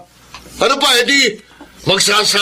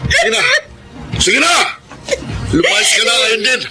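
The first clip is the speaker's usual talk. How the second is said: husband shouting at his wife to leave; shouting order; 6 semitones higher than usual. Acted. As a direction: drive her out with a yell.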